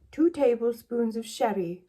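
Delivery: a hint of a recipe-reader's put-on voice; Delia Smith. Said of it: "Oh, she doesn't speak like that".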